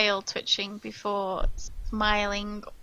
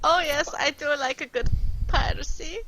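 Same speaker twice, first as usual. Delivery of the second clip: much higher and louder, breaking into laughter; camp tiefling laughing; 12 dB louder, faster than usual. Acted. Machi welcomes the party to his tavern, laughing through a high camp voice. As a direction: greet them grandly while cracking up. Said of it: "that's the wrong accent".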